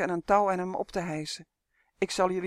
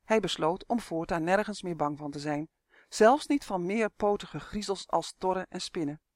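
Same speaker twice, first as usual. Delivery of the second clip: usual speaking voice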